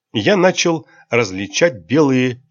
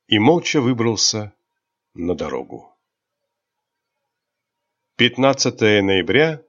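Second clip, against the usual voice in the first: ordinary delivery, nothing marked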